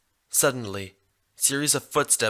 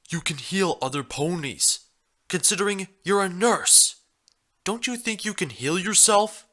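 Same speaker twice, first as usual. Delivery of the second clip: higher, odd-sounding stallion voice, matter-of-fact; the stallion, smugly insistent; 7 semitones higher than usual. A narrator's reading. Stallion lectures the hurt nurse, insistent and higher. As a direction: lay out his logic as if it's obvious.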